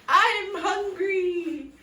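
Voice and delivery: loud, very slow, drawn-out whine; hungry dwarf. A narrator's reading. Hungry dwarf announces himself, long hungry moan. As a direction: stretch the words out with hunger. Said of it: "The hungry dwarf says".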